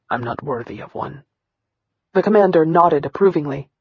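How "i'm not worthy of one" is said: hollow recital. Acted. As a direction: lower and much softer. Boy recites his humiliation.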